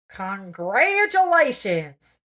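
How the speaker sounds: awkward. A narrator's reading. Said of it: "the smile on his face was odd".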